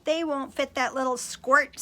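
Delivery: mock the little brother; sneering. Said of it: "sneered his brothers".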